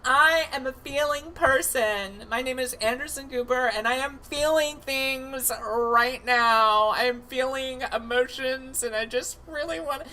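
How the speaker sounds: high, breathy-free overwrought feeling voice, pitched well up; mock Anderson Cooper.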